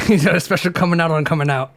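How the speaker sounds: laughing fast through the pun; giggling while repeating the line. Real, not acted.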